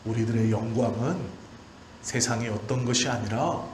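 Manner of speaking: ordinary talk, nothing marked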